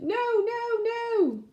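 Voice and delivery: high shouted mayor voice; mayor shouting. Acted. The mayor spotting the seed from his palace, high shout. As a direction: cry out from the palace in outrage. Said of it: "the mayor looked down and shouted".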